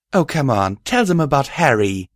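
usual speaking voice

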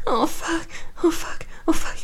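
high breathless cries of pleasure; moaning. Acted. Climax moaning.

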